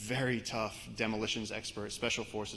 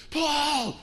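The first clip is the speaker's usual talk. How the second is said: high, very loud yell of a name; panicked scream; 10 dB louder than usual. Retold or imitated. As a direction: scream for help across distance. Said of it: "when you hear someone screaming".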